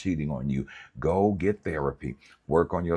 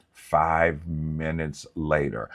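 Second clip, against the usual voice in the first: ordinary talk, nothing marked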